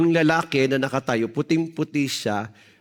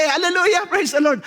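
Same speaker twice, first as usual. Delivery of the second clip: crowd shouting; 12 semitones higher than usual. Retold or imitated. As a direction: echo the cheering congregation. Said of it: "nagsisigawan kasi".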